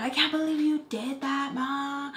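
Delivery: put-on high, drawn-out girlish complaint to mom; mock tearful whine. Embarrassed girl complains to her mom, mock tearful whine. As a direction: imitate a whining, upset little girl, dragging words out. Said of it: "the girl's just crying".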